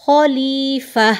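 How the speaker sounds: very slow खलीफा with a heavy full kha. Shown as a demonstration. Teacher models the heavy kha of खलीफा. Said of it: "खौ को आप मोटा करेंगे".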